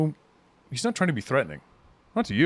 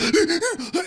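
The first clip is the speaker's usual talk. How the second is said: high panicked gasp of a waking man; sharp gasp; 4 dB louder than usual. Acted. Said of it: "he's like".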